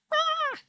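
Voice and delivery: a sudden very high piercing "ah", cut short; mock soprano shriek. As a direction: squeal like a high-pitched singer, briefly. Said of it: "it's not so like".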